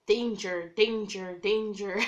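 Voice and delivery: loud alarm-bell chant; alarm in my head. Retold or imitated. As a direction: sound the internal alarm, loud. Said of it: "this in my brain".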